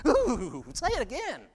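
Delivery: high-pitched hyena shivering with delighted fright; nervous hyena thrill. Acted. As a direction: squeal it like a thrill-seeker in a haunted house. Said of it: "They like being kinda nervous".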